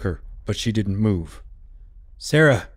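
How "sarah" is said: usual speaking voice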